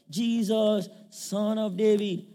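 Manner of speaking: a high, loud begging cry; calling out. Acted. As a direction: shout to be heard. Blind man calls out again.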